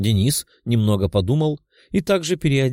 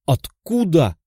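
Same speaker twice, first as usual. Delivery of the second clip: startled exclamation; 5 semitones higher, slower than usual. Acted. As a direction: blurt it out in shock. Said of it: "Воскликнул парень".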